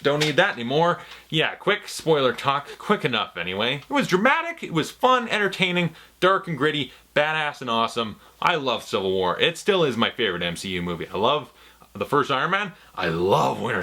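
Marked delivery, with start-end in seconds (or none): 3.8-8.2 excited. Real, not acted. Gushing about the movie.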